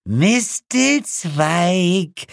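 cold, drawn-out matron; slow, drawn-out. Acted. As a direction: stretch the word very slowly. Grim woman speaks slowly. (langsam gedehnt)

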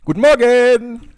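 high, loud, slow stretched morning greeting; loud drawn greeting. Acted. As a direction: belt it out like a wake-up call. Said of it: "Und der Motzkus schreit dich an".

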